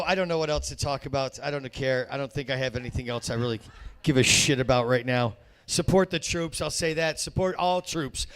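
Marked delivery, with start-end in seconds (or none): none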